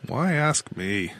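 slow, tired reply; weary. A narrator's reading. Control captain fends off a question, weary. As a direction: say it wearily, drawn out. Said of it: "said Jackson wearily".

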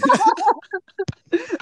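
very high, loud burst of laughter; high laughing fit. Real, not acted. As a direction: break into helpless laughter. Laughs at punching story. (tertawa terbahak-bahak dengan nada tinggi)

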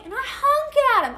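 ordinary delivery

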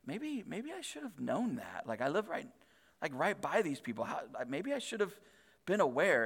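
soft, guilty second thoughts; hushed, regretful inner voice; feeling terrible. Acted. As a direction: voice quiet self-reproach, hesitating. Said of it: "then you feel terrible".

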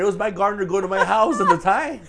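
hammed-up sob story; mock whiny excuse. Pretending to call in to the podcast, laughing mock whine.

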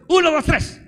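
preacher demanding a stronger cheer, shouting the count. Shown as a demonstration. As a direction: belt the count out all the way. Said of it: "Otra vez".

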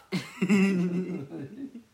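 loud, high-pitched laugh; amused laughter. Real, not acted. Host finishing fast rap story, loud amused laugh.

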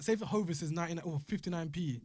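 normal talking voice

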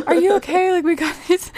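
a hint of frantic family; worried parents.

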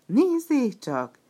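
loud, high, slow cry of discovery; shout. Acted. Arlecchino shouting as he spots Burattino in the crowd. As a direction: call out to the whole hall. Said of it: "kiáltott Arlecchino".

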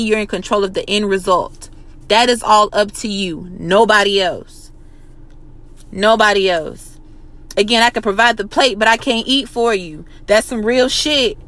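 normal talking voice

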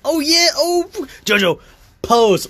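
high, loud hammed-up hype; mock-excited fan-service voice; excited posing. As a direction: strike a pose and shout it out.

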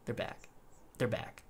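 a prince lost in his own head, softly muttering; creepy murmur. Acted. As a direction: murmur it to himself like a madman. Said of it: "the prince is still going".

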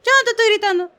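high, loud mock yell while denying; yelling denial. Brother yelling that he isn't yelling, high mock shout. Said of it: "Tú no me tienes por qué gritar".

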